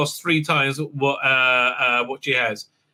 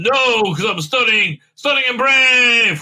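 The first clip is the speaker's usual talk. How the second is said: mock heroic shout; 6 semitones higher, 4 dB louder than usual. [name] defying her opponent in mock triumph. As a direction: declare it like a parody hero.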